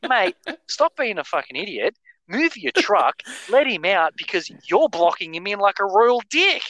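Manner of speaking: high-pitched mock indignation; indignant telling-off. Dick police to truck driver blocking a car in, indignant. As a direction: play the self-appointed enforcer scolding him.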